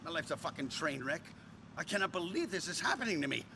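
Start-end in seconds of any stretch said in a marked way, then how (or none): none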